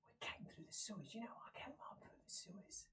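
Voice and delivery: whispered, almost voiceless; hushed sewer traveller. Acted. Game master voicing traveller explaining to the guard, hushed whisper.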